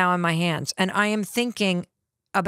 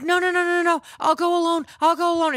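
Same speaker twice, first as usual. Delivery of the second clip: a rush of guilty refusals, pitched up; frantic protest; 8 semitones higher, faster than usual. Retold or imitated. Wife insisting to husband she'll go alone, frantic pleading.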